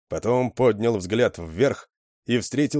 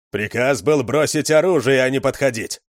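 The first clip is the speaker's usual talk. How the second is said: loud, sharp rebuke; angry command; 5 semitones higher, 6 dB louder than usual. A narrator's reading. Gunman rebukes Lyusya for approaching, loud and angry. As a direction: snap at her for stepping closer.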